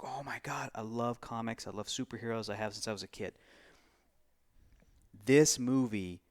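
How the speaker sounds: ordinary talk, nothing marked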